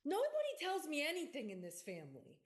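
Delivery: hammed-up high complaint, falling away; mock complaint. Daughter to parent about family secrets, mock-aggrieved. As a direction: play the left-out kid, pitched high.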